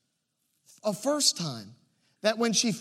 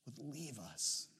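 usual speaking voice

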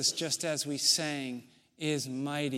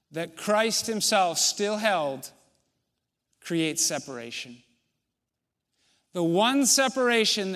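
louder preacher's push; preaching; 3 semitones higher, 5 dB louder than usual. Acted. Pastor urging the congregation about believers, raised preaching voice. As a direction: press the point home from the pulpit. Preacher presses the point again.

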